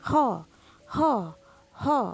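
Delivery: "Ho" with a plain breathy h, short and loud; wrong ho. Shown as a demonstration. Teacher imitating the common wrong kha. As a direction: say "Ho" as the mistaken light h. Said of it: "mengatakan kha itu sebagai ho".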